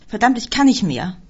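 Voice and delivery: restrained narrated shout. A narrator's reading. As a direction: cry out at the end of one's tether. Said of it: "schrie sie".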